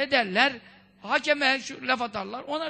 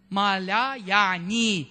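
drawn-out term; 4 semitones lower, much slower than usual. Shown as a demonstration. Preacher names the term.

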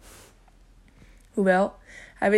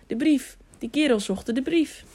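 higher-pitched, urgent exclamation; alarmed realisation; 5 semitones higher than usual. A narrator's reading. Tiuri realises mid-fight what his attackers want, alarmed. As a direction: voice lifts in sudden alarm. Desperate thought amid the fight.

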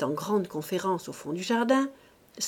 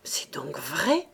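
murmured; 3 semitones lower, slower than usual. A narrator's reading. Old mother hearing the truth, slow whisper. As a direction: breathe it out in dismay. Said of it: "murmura-t-elle".

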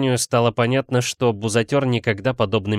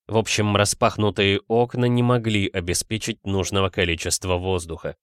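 ordinary delivery, nothing marked

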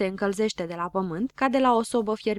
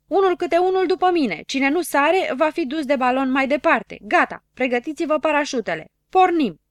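high, loud orders to the crew; Știe tot shouting jump orders to his friends; commanding shout; 7 semitones higher, 7 dB louder than usual. A narrator's reading. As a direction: command the jump over the wind. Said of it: "strigă el".

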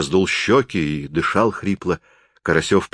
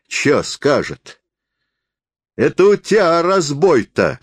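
higher, swooping sing-song peasant voice; jeering peasant forester; 8 semitones higher, 4 dB louder than usual. Acted. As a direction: scoff in broad country speech, high and emphatic. Forester mocks the indignant traveller. (насмешливый деревенский лесник, высоким голосом)

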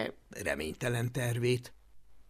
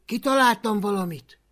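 ordinary delivery

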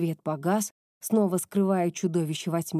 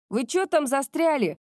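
shouting; 6 semitones higher than usual. Acted. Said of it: "крикнула Кёко".

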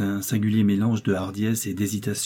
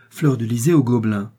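usual speaking voice

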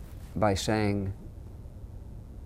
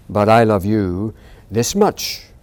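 slow, louder fond father voice; big hare answering; 7 dB louder, slower than usual. Acted. Big hare answering his son, slow fond boast. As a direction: outdo the child gently. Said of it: "he said".

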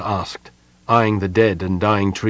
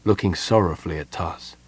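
plain reading voice

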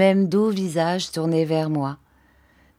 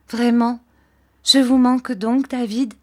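delighted, higher and louder; joyful; 6 semitones higher, 4 dB louder than usual. A narrator's reading. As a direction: lit up with joy at being missed. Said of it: "avec un joyeux sourire". (joyeuse, plus aiguë et plus forte)

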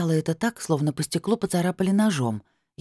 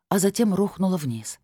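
plain reading voice

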